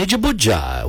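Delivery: ordinary talk, nothing marked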